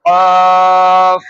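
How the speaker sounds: কফ drawn out, loud, from the tongue root; long heavy qaf. Shown as a demonstration. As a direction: hold কফ long and thick from the throat. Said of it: "কিভাবে উচ্চারণ হবে?". (জিহ্বার গোড়া থেকে টেনে মোটা কফ)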